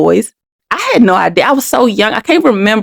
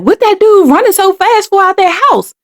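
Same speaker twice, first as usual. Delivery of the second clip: imitating neighborhood friends; 10 semitones higher than usual. Mother's friends asking why the man runs out, in a higher puzzled voice. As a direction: play a nosy friend wondering aloud. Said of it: "they probably like".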